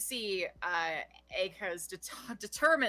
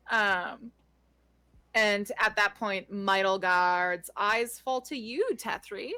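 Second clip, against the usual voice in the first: usual speaking voice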